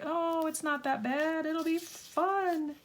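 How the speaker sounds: high, drawn-out singsong pep talk; mock cheerful self-talk. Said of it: "retrain my brain to think".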